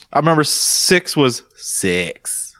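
ordinary talk, nothing marked

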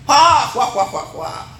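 high, loud hammed-up guffaw "juá, juá"; mock crowd laugh. Preacher mimics the congregation laughing at an impression. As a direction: burst out like the whole room laughing. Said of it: "alguien imita a Paula".

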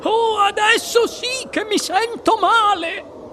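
very high, slow wail; sustained high-pitched cry of woe; shrieking in distress. A narrator's reading. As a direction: squeal it out, queasy and stretched. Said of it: "strillò la signora".

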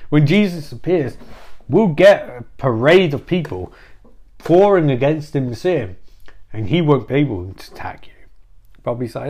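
ordinary talk

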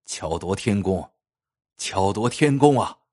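slow, low marvelling; repeated admiration. A narrator's reading. Prefect examining the jade bracelet, admiring it slowly. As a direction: draw it out in wonder, low at first, then a little stronger. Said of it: "嘴里不停地说".

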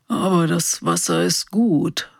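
hoarse, barely-there whisper; a rasping old man's whisper; creaky whisper. Acted. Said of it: "nicht mehr als ein heiseres Flüstern".

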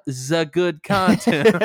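put-on slow "za good content" in a comic accent; foreign accent on THE. Co-host reenacts his slip, drawn-out mock foreign accent. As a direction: stretch it out, saying THE as "za". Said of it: "it was only for the word the".

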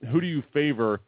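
ordinary talk

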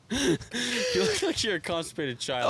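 high, slow tease through laughter; real high-pitched laughing; cracking up. Real, not acted. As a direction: squeeze the words out while laughing. Laughing at the other's demonstration.

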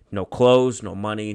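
normal talking voice

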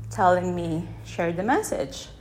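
normal talking voice

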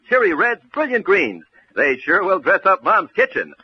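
usual speaking voice

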